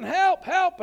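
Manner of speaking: mock cry for help. Man retelling his balcony fall to Peter, mock yelling. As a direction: yell help like you're dangling off a balcony, half in fun. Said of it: "and I'm screaming".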